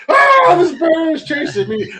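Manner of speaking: high, slow, mock-terrified wail; mock scared scream. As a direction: squeal like you're running from a bear. Friend screaming, fleeing a bear.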